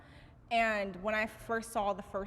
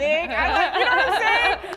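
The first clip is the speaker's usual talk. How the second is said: louder and higher through real laughter; laughing; 10 semitones higher, 14 dB louder than usual. Real, not acted. Guest laughing while admitting he likes tech music. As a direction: break into laughter mid-sentence.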